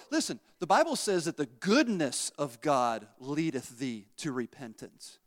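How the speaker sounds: ordinary delivery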